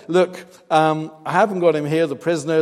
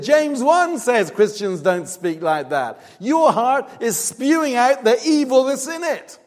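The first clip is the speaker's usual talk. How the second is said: loud re-enacted rebuke, climbing higher; scolding rebuke; 9 semitones higher, 5 dB louder than usual. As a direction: build to a high, loud denunciation, acting it out. Acts out his rebuke of woman.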